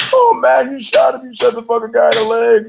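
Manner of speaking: high, loud panicked voice; frantic passenger, pitched high; freaking out. Retold or imitated. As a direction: pitch up and panic about the shooting. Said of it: "the guy he's driving with is freaking out".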